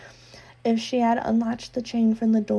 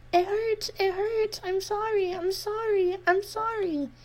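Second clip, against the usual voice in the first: put-on repeated pained girlish sorry; pained apologies; 11 semitones higher than usual. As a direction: whimper sorry over and over. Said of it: "apologies twisted with pain".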